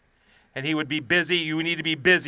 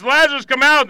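higher voice, moderately raised; raised commanding call; 7 semitones higher, 6 dB louder than usual. Acted. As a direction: read the call out quickly in a preacher's raised voice. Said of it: "called in a loud voice".

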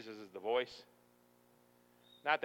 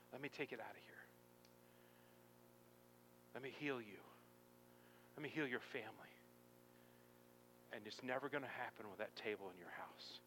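very soft, tender appeal; hushed pleading; 2 semitones lower, 14 dB quieter than usual. Acted. God offering to heal a son's family, hushed pleading. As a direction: plead gently, leaving space between phrases.

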